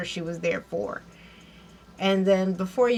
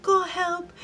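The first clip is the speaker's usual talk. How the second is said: high, slow, hammed-up plea for help; fake plea; 10 semitones higher, 4 dB louder, slower than usual. Van fakes panic to the concierge, high slow hammed-up plea. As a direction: overact a desperate plea. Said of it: "acting bit with the concierge".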